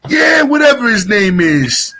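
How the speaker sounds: a hint of a Jersey Italian uncle on the phone; Tony Danza-style uncle. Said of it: "he talks like Tony Danza".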